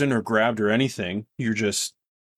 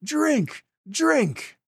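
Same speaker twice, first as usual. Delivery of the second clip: much higher chanted drink, drink, drink; crowd shouting; much slower than usual. Acted. The whole bar urging Graham, high chanted shout. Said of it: "the whole bar is shouting".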